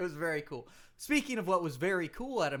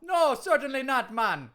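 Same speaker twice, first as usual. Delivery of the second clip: cheery put-on Jamaican accent, raised pitch; hammed-up Jamaican accent; Kofi Kingston, Jamaican accent; 5 semitones higher, 5 dB louder than usual. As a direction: greet them in a mock Jamaican lilt. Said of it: "You talk funny now".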